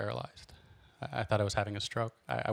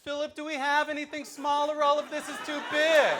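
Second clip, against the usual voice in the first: high, fussy put-on voice; prissy shopper; 4 dB louder than usual. Calling to the clerk for a smaller size, high and fussy. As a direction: sing it out like a delighted diva.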